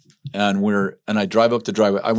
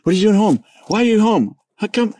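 startled wife; 11 semitones higher, 3 dB louder than usual. Retold or imitated. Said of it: "She's like".

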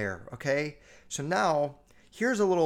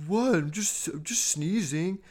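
slower, put-on voice of the kid; mock sneezing kid; 4 semitones higher than usual. Comic imitating a classmate's defence of his sneeze, put-on voice. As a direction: play the kid who sneezed, mockingly defensive.

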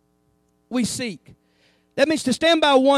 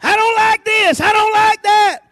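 loud, higher whiny gripe; mock complainer; 6 semitones higher, 7 dB louder, slower than usual. A preacher mocking grumbling churchgoers, loud and higher. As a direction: gripe like a sour church member. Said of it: "Everybody thinks".

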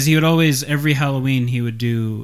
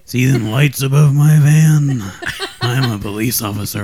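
creepy fake cop voice; 5 dB louder than usual.

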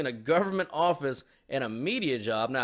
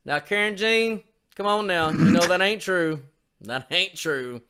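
folksy southern drawl, pitched up at first; southern drawl sinking to a slow finish; 4 semitones higher, 4 dB louder than usual. Acted. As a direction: chide her in a southern drawl. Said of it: "can do the southern drawl really good".

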